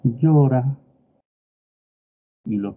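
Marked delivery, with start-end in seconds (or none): 0.0-0.8 "Llora" said higher and louder with a Pasto rising melody; Pasto sing-song. Shown as a demonstration. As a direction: give "Llora" the lilting Pasto tune, worried. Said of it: "el pastuso le va a decir".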